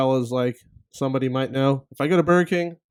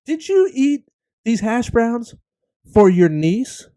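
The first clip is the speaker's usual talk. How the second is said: slow, high accusing question; mimicking the wife; 8 semitones higher, 4 dB louder, slower than usual. Husband imitates his wife accusing him over hash browns. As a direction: draw it out like a suspicious wife. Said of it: "She's gotta add that too".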